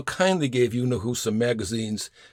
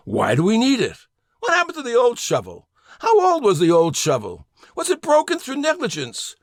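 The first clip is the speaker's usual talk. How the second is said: rising, louder nitpicking townie questions; hammed-up skeptical citizen, voice climbing higher; mock indignant questioning; 11 semitones higher, 5 dB louder than usual. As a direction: fire off the questions like a fussy townie at meeting. Townies grilling over a shovel.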